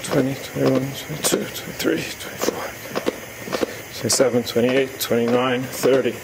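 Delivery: breathless rhythmic counting; counting cpr compressions. Real, not acted. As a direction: count while pumping hard.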